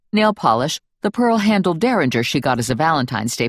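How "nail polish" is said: ordinary delivery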